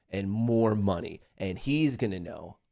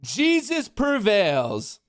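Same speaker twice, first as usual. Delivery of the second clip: higher, louder, slow and grand; triumphant proclamation; 11 semitones higher, 4 dB louder, much slower than usual. Real, not acted. Streamer celebrating his winning trade, triumphant. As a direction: proclaim victory, drawn out.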